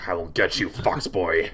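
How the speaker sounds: villainous warlock threatening his foe; dark character voice, swelling then dropping back; menacing warlock. Acted. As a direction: gloat like an evil sorcerer.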